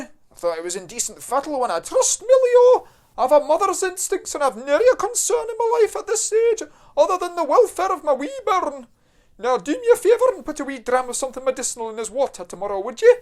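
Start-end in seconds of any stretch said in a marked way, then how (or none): 1.8-13.1 high, loud broad Scottish mother; broad Scottish accent. Acted. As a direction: play a doting Scottish mum, high and loud. Scottish mum asks for dram.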